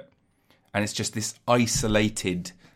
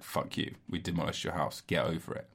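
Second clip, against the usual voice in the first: hushed mock; 6 semitones lower, 12 dB quieter than usual. Demolishers voiced.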